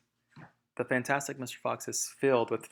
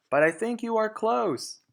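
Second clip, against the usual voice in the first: high, louder call; shouting; 10 semitones higher, 5 dB louder than usual. Acted. Boggis shouting to Bean over the noise, high and louder. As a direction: keep shouting across.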